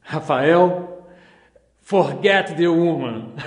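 slow, emphatic quoted advice in English; Paul. Acted. Rafael recalling his hero's advice, slow emphatic impression. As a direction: deliver it slowly and firmly, as a quote. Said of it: "Ele me dizia".

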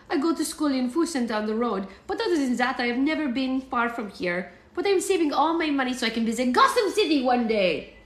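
eager child with a hammed-up German accent; cute German accent. A narrator's reading. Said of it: "in a cute German accent".

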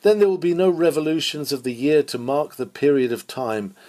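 ordinary delivery

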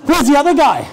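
bewildered audience member's outburst; baffled staff. Retold or imitated. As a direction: staff staring at the CEO, half-laughing disbelief.

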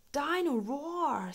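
excited. A narrator's reading. George names his new toy, excitedly. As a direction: savour the name with delight. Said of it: "says George excitedly".

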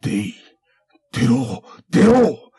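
building to a very high loud yell; frantic angry shout. Acted. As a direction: scream it in fury. Samurai challenges hidden foe.

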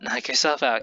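ordinary delivery

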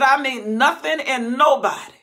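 loud, high proclamation; full-force preaching; preaching shout. Acted. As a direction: thunder it out. Preaching God's words loudly.

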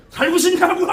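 very high, loud demand; mock threatening yell. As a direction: bellow it as an impatient possessed shaman, hammed up. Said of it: "이러고 쳐다봐".

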